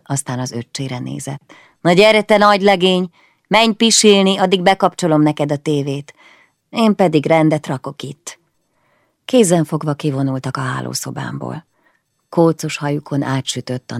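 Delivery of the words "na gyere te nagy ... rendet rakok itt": coaxing big sister. Acted. Big sister coaxing little brother.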